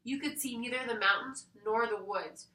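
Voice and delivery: ordinary delivery, nothing marked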